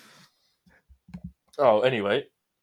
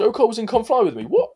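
much higher and louder, bursting with the news; can't believe what you just found; real thrill at a surprising fact; excited discovery; 10 semitones higher, 4 dB louder than usual. Real, not acted.

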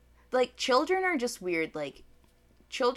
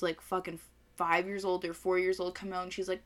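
ordinary talk

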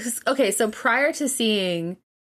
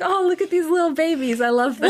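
cooing at babies; 9 semitones higher, faster than usual. Retold or imitated. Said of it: "That's what it felt like".

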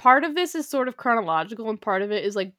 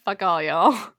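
louder cheeky defiance; mock-tough dismissal; defiant; 3 semitones lower, 6 dB louder, slower than usual. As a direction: fling it out boldly. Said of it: "so I'm just like".

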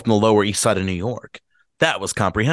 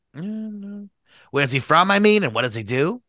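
drawn-out question; 7 semitones higher, slower than usual. Acted.

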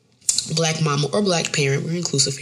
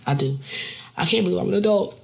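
ordinary talk, nothing marked

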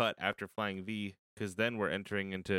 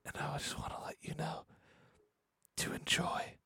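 breathy hushed whisper; 7 semitones higher, 8 dB quieter than usual. Acted. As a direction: whisper it close to the mic. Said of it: "back to the energy level that we started".

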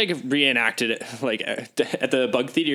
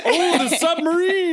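high playful feeding voice; feed a toddler with a spoon; airplane spoon game; 13 semitones higher, 5 dB louder than usual. Pretend. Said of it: "Do I have to eat it".

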